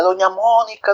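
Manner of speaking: high distant call; calling from afar. A narrator's reading. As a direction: call the neighbour from far off. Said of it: "gritando desde lejos decía".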